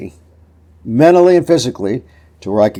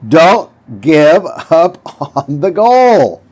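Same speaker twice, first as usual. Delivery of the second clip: rising fired-up exhortation; 6 semitones higher, 4 dB louder, much slower than usual. Real, not acted. Repeated motivational push to viewers.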